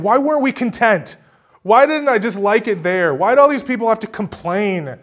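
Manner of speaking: a touch of a high, fretful Joshua; panicky Joshua. Retold or imitated. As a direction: lightly voice a fretting leader. Joshua voiced panicking, looking back.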